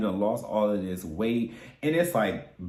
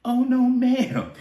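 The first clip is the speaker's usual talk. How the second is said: sassy no ma'am; 12 semitones higher, 4 dB louder than usual. Retold or imitated. Sassy refusal.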